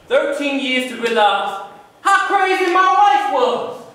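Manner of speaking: stage piece, high and projecting; dramatic monologue. Acted. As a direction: declaim it slowly, high and exasperated. Performs monologue.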